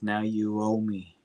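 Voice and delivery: a hint of a gangster calling in a debt, softly; Kingpin menace. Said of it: "The Kingpin says".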